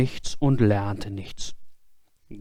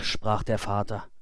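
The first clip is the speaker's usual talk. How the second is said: ordinary delivery, nothing marked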